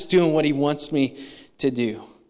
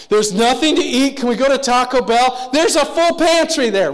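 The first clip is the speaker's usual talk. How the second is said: put-on high, loud whining teen staring at a full pantry; whiny teenager; 9 semitones higher, 8 dB louder than usual. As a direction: whine like a spoiled kid. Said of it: "You ever have a teenager look at the pantry?".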